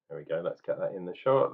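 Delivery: ordinary delivery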